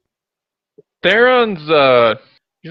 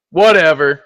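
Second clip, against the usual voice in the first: louder, curt brush-off; dismissive whatever; 7 dB louder than usual. Acted. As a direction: toss it off and turn away. Theron dismisses captain and leaves.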